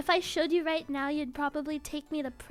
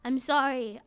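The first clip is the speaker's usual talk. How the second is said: ordinary delivery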